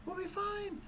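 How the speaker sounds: high, soft mock reassurance; retold soothing. Parent to panicking daughter in the stuck van, soft high reassurance. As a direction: imitate calming a child in a high, hushed voice.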